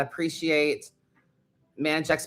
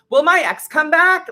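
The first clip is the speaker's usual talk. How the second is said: mock needy question, much higher and louder; shallow querent; 12 semitones higher, 7 dB louder than usual. Mocking a client who asks if her ex returns, high and loud. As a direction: whine it like a clueless client. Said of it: "questions instead of".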